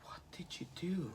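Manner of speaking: whispered, aghast question; barely-there shocked whisper; hushed disbelief. Retold or imitated. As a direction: whisper it in stunned horror. Peter's shocked reply retold.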